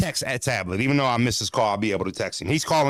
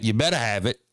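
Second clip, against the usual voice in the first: ordinary delivery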